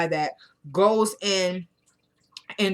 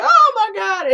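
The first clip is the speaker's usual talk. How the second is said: high, loud mock wail; hammed-up horrified outcry; freaking out; 14 semitones higher, 7 dB louder, slower than usual. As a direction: imitate a woman losing it, pitch shooting up. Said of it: "she starts freaking out even more".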